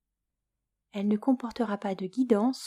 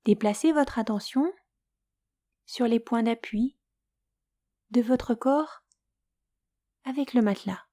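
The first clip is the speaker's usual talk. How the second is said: guided Yoga Nidra; 2 semitones higher than usual. Acted. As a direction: lull the listener toward sleep, unhurried and hushed at times. Leads Yoga Nidra for sleep.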